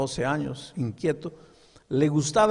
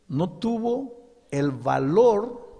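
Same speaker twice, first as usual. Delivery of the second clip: very slow, weighted preaching; emphatic slow; 5 semitones higher, 3 dB louder, much slower than usual. Real, not acted. Preacher stressing the pastor lacked courage, very slow. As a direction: drag each word for weight.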